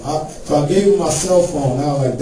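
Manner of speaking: ordinary talk, nothing marked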